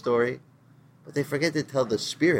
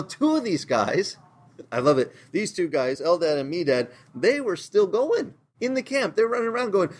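ordinary delivery

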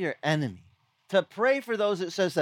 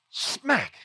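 imitated slap; 8 semitones higher than usual. Brother slapped again and again.

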